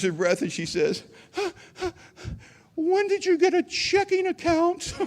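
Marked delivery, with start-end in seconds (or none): 2.8-4.9 high, slow, mock-tearful squeal of a mother; hysterical mom. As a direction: ham up your weepy, disbelieving mom. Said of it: "my mom's hysterical on the phone".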